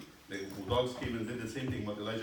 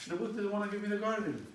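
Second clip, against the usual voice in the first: high, whiny complaint; sulking king; 10 semitones higher than usual. Acted. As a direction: whine like a spoiled child.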